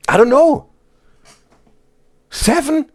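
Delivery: high, incredulous questioning; baffled. Real, not acted. Streamer counting coincidences in the chat, baffled. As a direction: pitch it up in disbelief.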